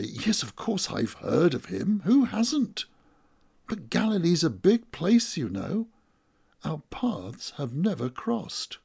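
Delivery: loud, high, over-insistent brush-off; blustering cover-up, pitch swooping up; bluffing. A narrator's reading. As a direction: protest too much while playing it casual. Bluffs to cover his lie.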